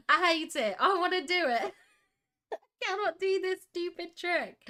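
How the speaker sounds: high, laughing wail of frustration; exasperated whine. Real, not acted. Streamer who cannot do the trick, wailing playfully. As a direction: whine helplessly through a laugh. Vents about a failed lighting trick.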